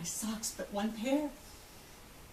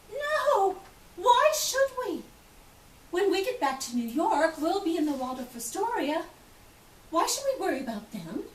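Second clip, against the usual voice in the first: much higher, snooty and dismissive; haughty refusal; 7 semitones higher than usual. Retold or imitated. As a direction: look down on the poor women. Haughty rich women refuse donation.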